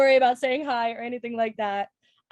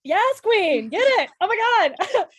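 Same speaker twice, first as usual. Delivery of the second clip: high, loud cheer for a friend; excited cheering; 8 semitones higher, 6 dB louder than usual. Real, not acted. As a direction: hype her up, big and loud. Hyping up friend after intro.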